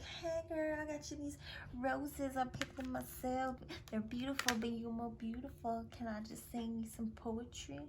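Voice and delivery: a courting prince sweet-talking softly; smooth suitor. Retold or imitated. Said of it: "courting her, like".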